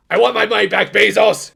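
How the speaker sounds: loud mock outrage at Bezos; shouting. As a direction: yell it like a furious customer. Mock rant at streaming service.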